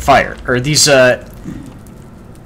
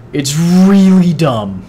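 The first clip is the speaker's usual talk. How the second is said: slow, higher, dragged-out scorn; repeating the gripe even slower; drawn-out disdain; 4 semitones higher, 4 dB louder, much slower than usual. Real, not acted. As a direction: lean on really and drag the words out. Repeats the complaint.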